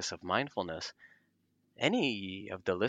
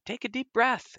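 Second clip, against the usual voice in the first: much higher, imitated exhortation; urging someone distressed; 4 dB louder than usual. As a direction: act out urging a worked-up person to breathe. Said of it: "somebody might say, especially".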